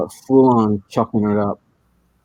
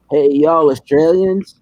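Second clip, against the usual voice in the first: loud, higher, slow Texan drawl; cartoon Texan; 6 semitones higher, 4 dB louder, slower than usual. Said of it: "a real Texan type thing, imagine in, in cartoons".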